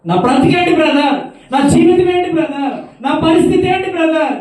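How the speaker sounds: high, loud wailing lament; despairing caller. Retold or imitated. The woman crying to the brother about her ruined life, loud wailing lament. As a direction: wail in hopeless despair.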